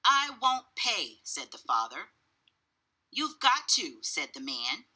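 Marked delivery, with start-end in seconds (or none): 0.0-1.2 higher, louder, emphatic; defiant refusal. A narrator's reading. Father argues with the cashier, defiant. 3.1-4.0 higher, emphatic comeback; insistent retort. A narrator's reading. Cashier answers the refusing father, insistent.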